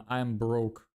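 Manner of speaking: normal talking voice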